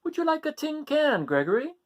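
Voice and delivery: high sweet mother goat voice. Acted. Mother offering her son a tin can, high coaxing. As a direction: coax him like a doting mother. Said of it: "asked Mother Goat".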